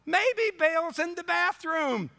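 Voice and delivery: high, mocking jab; sarcastic. Preacher joking to congregation about Baal, sarcastic and high. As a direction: twist the knife with a grin. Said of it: "I love sanctified sarcasm".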